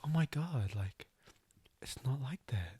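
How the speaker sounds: whispered, brushing it off; hushed dismissal. Retold or imitated. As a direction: wave it away under your breath. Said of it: "And she was just like".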